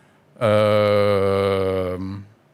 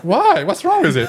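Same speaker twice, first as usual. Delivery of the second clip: surprised; 4 dB louder than usual. Retold or imitated. Retold indignant reply.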